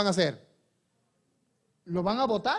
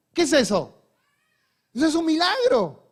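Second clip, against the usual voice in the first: higher and louder, proclaiming; preacher loudly declaring a miracle; preaching fervor; 7 semitones higher, 5 dB louder than usual. Acted. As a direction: drive it home to the congregation.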